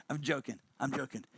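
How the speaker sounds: low, soft, laughing through the words. Real, not acted. Coach laughs off his ex-wife jab, soft and amused. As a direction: chuckle while taking it back. Teasing joke about ex-wife.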